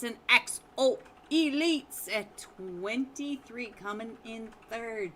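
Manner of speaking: loud, drawn-out scoreboard announcement; esports announcer. Acted. Caster welcoming viewers back to the scores, big announcer voice. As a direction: boom out the standings with long stretched words.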